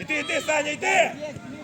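ordinary talk, nothing marked

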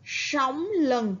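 sóng with a curled s, lừng with ưng rhyme; sóng lừng said clearly, tongue curled on s; model reading. Shown as a demonstration. Teacher models the word for pupils. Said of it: "Các bạn hãy lắng nghe cô đọc".

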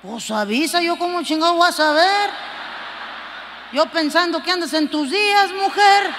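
más fuerte al final, gritando mujer; suéltalo como reclamo de macho; marido gritón. Acted. Marido contesta en sketch. (marido que le grita a su esposa)

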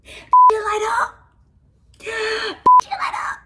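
high, loud and drawn out in delight; gushing high over a gift that lights up; squealing with delight. Real, not acted. Vlogger squealing high to the camera at a glowing gift. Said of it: "And it light up".

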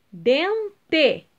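"Dente" split in two, final "te" stronger; stress on last syllable. Shown as a demonstration. Teacher giving pupils an example, stressing final "te" in "Dente". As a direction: separate "Dente" and lean on the closing "te". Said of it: "O T é a sílaba tônica".

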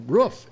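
roof with the vowel of "book"; short-vowel roof. Shown as a demonstration. Showing the panel how he says it, short-vowel roof. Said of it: "is how I say".

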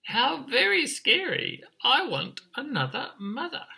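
very high, swooping chick cry; chirping chick. Acted. Emu chick is scared of sneaky eyes, high chirp. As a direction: pipe up in alarm like a baby bird. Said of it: "and he chirped".

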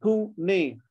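PUNE in two beats: Pu, ne; split into syllables. Shown as a demonstration. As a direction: pause between the halves of Pune. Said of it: "two in each".

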